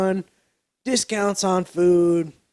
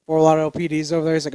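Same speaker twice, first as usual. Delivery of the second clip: ordinary talk, nothing marked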